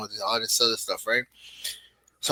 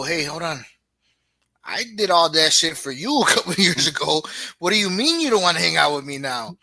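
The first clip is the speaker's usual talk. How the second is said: higher, louder mock-outraged father; indignant dad; 5 semitones higher, 5 dB louder than usual. Father to teenager who won't hang out, mock indignant. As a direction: play the hurt dad, half joking. Father protests to grown kid.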